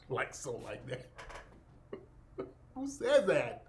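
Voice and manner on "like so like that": the catchphrase "like so, like that" muttered much softer through a laugh; a soft chuckling echo of the catchphrase; quiet mock repeat. Streamer echoing the influencer's catchphrase to himself, quiet and amused. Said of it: "she sounds like Mammy, our maid".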